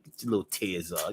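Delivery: a soft murmured aside; quiet. Shown as a demonstration. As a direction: say it under your breath. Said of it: "repeated line".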